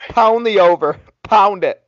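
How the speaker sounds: fired-up betting call; hyped up. Real, not acted. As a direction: pump up the bet like a fan.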